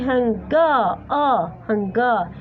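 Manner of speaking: slow "هنغا", stretching the vowel after G; schwa drawn out. Shown as a demonstration. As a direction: isolate and lengthen the final "ah" of "هنغا". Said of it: "فقط حرف الشوا هو الفرق".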